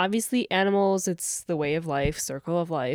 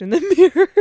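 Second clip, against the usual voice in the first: laughing; 9 semitones higher, 11 dB louder, much faster than usual. Real, not acted. Teasing joke, laughing.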